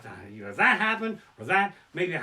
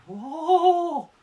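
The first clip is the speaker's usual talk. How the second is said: despairing cry; 12 semitones higher, 7 dB louder than usual. Acted. Punchline cry.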